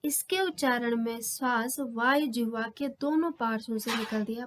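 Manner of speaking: ordinary talk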